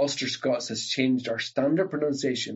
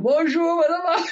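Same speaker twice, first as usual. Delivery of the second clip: high, loud mocking Bonjour madame; shouted greeting; 6 dB louder than usual. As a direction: call it out high and cheeky like a boy. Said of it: "you shout out".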